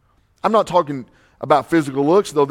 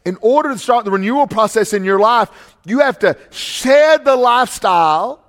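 raised, urgent pulpit voice; preaching at full force; 8 semitones higher, 6 dB louder than usual. Real, not acted. Pastor warning the church about the culture, preaching hard. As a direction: drive the warning home to the congregation. Preacher urges shedding old life.

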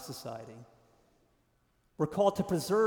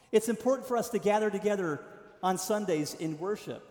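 ordinary delivery

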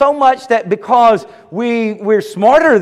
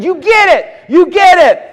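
excited Jesus; 8 semitones higher, 3 dB louder than usual. Retold or imitated.

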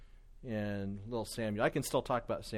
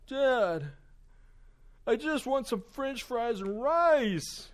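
high, loud mock tantrum; imitating a son's slow, high-pitched whine; whining child; 11 semitones higher, 6 dB louder, much slower than usual. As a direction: wail it like a thwarted little boy, dragging the words out. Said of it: "all of a sudden his attitude changes".

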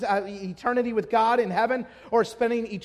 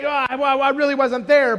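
flustered, louder cover-up; frantic excuse; 3 semitones higher, 7 dB louder than usual. Acted. Sinner caught trying to cover up sin, frantic excuse. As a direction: blurt out a frantic denial. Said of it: "the frantic nature of trying to cover up sin".

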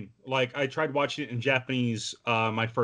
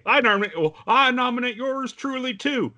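movie scene quarrel; 12 semitones higher, 3 dB louder than usual. Retold or imitated. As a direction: play a self-important rival in a film squabble. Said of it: "reminds me of a scene from Oh Brother".

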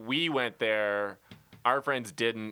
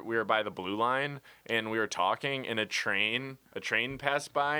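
ordinary talk

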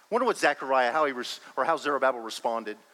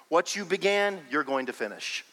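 ordinary delivery

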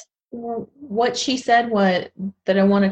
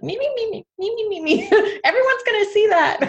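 high-pitched mocking critic voice; nagging inner critic; 14 semitones higher, faster than usual. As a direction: squeak like a pesky critic perched on the shoulder. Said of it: "Our critics are very much with us. They're kinda sitting on our shoulder like".

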